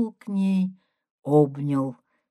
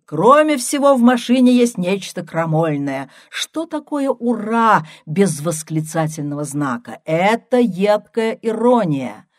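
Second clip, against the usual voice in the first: higher and louder, half-teasing; 5 semitones higher, 7 dB louder than usual. A narrator's reading. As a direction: raise your voice for her to hear. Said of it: "продолжал уже громче".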